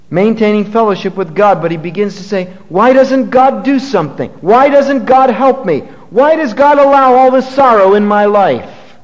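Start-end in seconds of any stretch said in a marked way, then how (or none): 2.7-9.0 a raised, pleading cry of why; anguished complaint, higher and louder; complaining believer. Retold or imitated. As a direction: voice the man's loud complaint to God.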